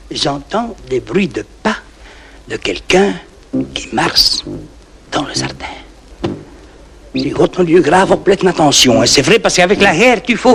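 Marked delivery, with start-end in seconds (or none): 0.1-5.6 softer and lower, fading to almost nothing; hushed suspense. Acted. Farmer telling the audience about the footsteps, hushed and fading. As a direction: drop the voice as if listening at night.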